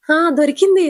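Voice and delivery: a sudden excited exclamation; excited find. Acted. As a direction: blurt it out as if you just found it. She finds the phone number.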